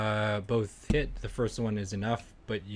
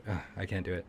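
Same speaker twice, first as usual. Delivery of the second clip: usual speaking voice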